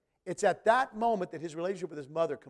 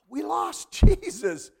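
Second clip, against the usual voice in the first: high, loud, slow gasp that breaks into a laugh; gasping panic; 11 semitones higher, 10 dB louder, slower than usual. Acted. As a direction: play the comic panic of losing a child. Family realizes Jesus missing.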